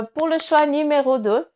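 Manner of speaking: usual speaking voice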